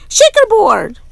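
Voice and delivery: put-on high, loud kid's shout. A classmate shouts a pattern at Camila, high and loud. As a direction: shout it out to tease. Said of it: "Someone else shouted".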